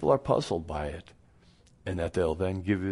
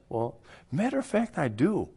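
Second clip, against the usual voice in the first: high, playful aside; mock coy reply; 8 semitones higher than usual. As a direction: tease the skeptic, pitched up. Playful answer to skeptic.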